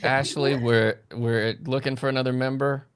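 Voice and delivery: ordinary talk, nothing marked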